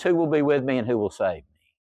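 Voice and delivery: ordinary talk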